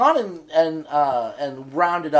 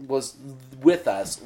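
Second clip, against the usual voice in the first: normal talking voice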